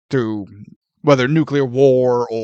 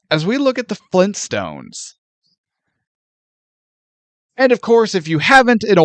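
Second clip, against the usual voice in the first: podcast outro; 6 semitones higher than usual. Acted.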